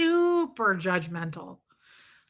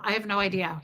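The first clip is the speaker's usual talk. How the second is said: usual speaking voice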